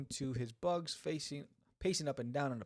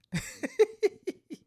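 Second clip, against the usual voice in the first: high burst of real laughter; 8 dB louder than usual. Real, not acted. Laughing at the gang story, real high laugh. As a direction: crack up at the memory.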